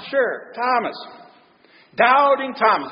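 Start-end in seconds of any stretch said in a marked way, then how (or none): none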